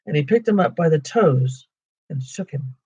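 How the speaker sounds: plain reading voice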